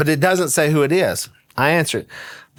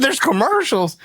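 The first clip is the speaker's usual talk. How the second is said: commercials? pitched high in disbelief; a stunned there's commercials?; incredulous question; 9 semitones higher than usual. Retold or imitated. Son hears of the ad, asks high and stunned. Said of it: "I said".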